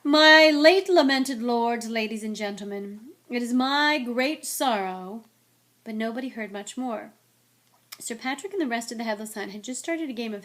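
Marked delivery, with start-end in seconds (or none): 0.0-5.2 slow, loud, solemn oratory; grand speechmaking. A narrator's reading. Nick addressing ghosts from the podium, grandly mournful. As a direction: proclaim it ceremoniously, stretching each phrase.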